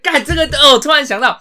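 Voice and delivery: loud and high, a burst of recall; excited realization. Real, not acted. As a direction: shout it out as the idea strikes. Sudden recollection, outburst. (灵光一闪，激动地高声喊)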